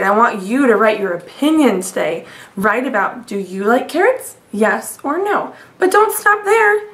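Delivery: ordinary delivery, nothing marked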